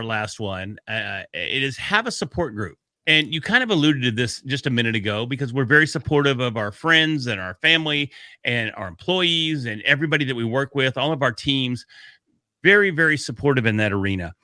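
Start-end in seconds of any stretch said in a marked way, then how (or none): none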